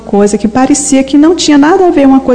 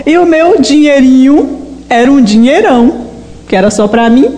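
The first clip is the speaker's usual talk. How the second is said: ordinary talk, nothing marked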